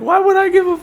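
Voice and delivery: high-pitched, exasperated questioning; incredulous. Retold or imitated. Man privately reacting to woman's purse story, incredulous. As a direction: ask it high, like it's absurd.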